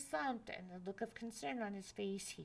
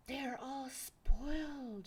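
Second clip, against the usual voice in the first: 1 dismayed exclaim; 4 semitones higher, 6 dB louder, slower than usual. Acted. Mother sees ruined landscape.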